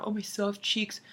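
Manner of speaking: soft, sing-song mockery; mocking quote. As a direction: parrot the lyric to make fun of it. Mocks a dumb lyric.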